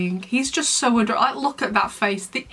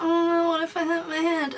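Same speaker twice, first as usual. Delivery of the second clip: mock whiny voice, higher; whiny Nathaniel; 7 semitones higher than usual. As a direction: whine it like a scared wimp. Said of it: "was so whiny".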